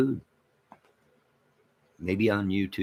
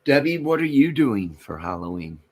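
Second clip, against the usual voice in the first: loud call; 5 semitones higher, 4 dB louder than usual. Shown as a demonstration. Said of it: "repeats the question louder".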